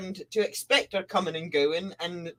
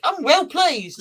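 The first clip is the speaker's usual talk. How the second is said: higher, louder smug self-praise; pleased with herself; 8 semitones higher, 5 dB louder, slower than usual. Acted. Sun Mother admiring her plants, lightly smug and pleased. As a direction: pat yourself on the back.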